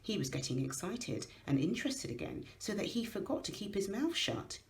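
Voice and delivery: ordinary delivery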